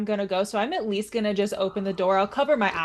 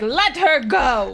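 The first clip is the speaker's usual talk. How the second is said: loud, slow commanding shout; 5 semitones higher, 6 dB louder, slower than usual. Acted. Hero shouting at the bugbear to release her, loud. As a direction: bark it at the bugbear. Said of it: "just be like".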